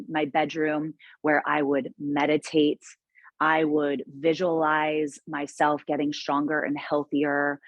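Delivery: usual speaking voice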